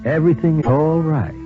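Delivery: ordinary talk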